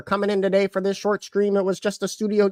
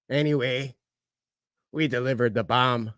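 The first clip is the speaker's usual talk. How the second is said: very low, subdued shark hunter; quiet, deep war-story impression; Quint; 10 semitones lower, 5 dB quieter than usual. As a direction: let it sink low and trail away. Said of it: "that was my impersonation".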